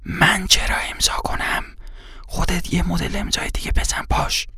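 urgent private whisper, very soft; hushed whisper. Acted. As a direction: whisper so only the two of you hear. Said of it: "آرام، جوری که فقط خودمان بشنویم".

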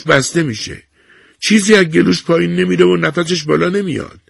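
patient, plain telling of hardship; old woman telling the nurse about her grandson. A narrator's reading.

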